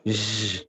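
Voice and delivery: low, buzzing "Z" like zh; model z sound. Shown as a demonstration. Teacher models the z-sh sound for students to copy. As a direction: buzz "Z" between z and sh. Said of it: "Lihat saya".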